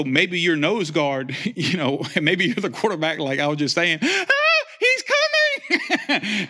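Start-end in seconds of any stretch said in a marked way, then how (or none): 4.0-6.5 very high, drawn-out laughing squeal; mock panic. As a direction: squeal like a scared quarterback. Quarterback panicking at linebacker.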